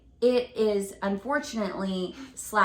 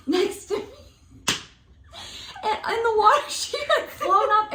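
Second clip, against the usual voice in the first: high laughing voice; 11 semitones higher, 4 dB louder than usual. Real, not acted. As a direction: laugh through it. Laughing while telling.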